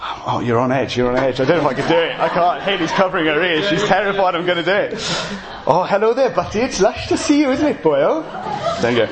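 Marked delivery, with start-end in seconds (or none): 5.7-8.2 high, sing-song Welsh accent impression; hammed-up Welsh greeting. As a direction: put on a playful Welsh lilt, pitched up. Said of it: "was gonna impersonate that".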